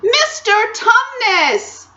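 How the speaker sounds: high, drawn-out loud yell; bawling. A narrator's reading. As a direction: bawl it right into his ear. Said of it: "bawled Lucy in his ear".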